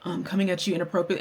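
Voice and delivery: soft, low, backing-down apology; subdued, hesitant sorry; retold apology. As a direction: apologise meekly and quietly, imitating someone. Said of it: "she apologized".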